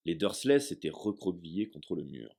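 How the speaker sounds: ordinary delivery, nothing marked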